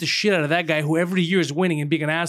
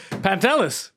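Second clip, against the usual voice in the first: usual speaking voice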